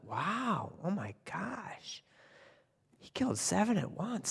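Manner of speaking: soft, high, hushed amazement; awed whisper. Acted. Townspeople whispering to each other about the tailor, awed. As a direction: gasp it under your breath in awe.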